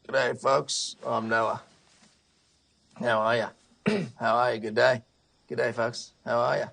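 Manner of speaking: a hint of a folksy Noah character; lightly voiced Noah. Noah greets all the animals on the boat, lightly voiced character. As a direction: lightly put on a matey greeter. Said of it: "And he says".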